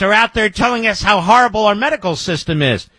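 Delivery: usual speaking voice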